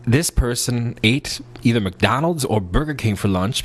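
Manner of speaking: usual speaking voice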